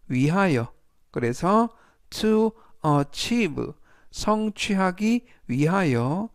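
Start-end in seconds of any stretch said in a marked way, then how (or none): 2.1-3.7 "to achieve" spoken slowly and clearly; slow model reading. Shown as a demonstration. Teacher reading the example phrase to learners, slow and clear. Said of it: "활용 문장 보시겠습니다".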